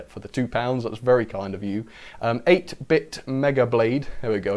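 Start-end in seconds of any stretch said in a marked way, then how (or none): 2.5-4.1 slow, deliberate name readout; said slowly. Real, not acted. Streamer reading out a donor's username to thank them, slowly. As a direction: take the name slowly, word by word. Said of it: "It works better if I say it slowly".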